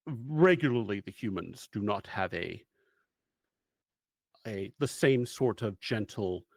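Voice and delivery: lightly voiced, solemn mission briefing; measured storyteller laying out the mission; game master's briefing. GM scene briefing narration.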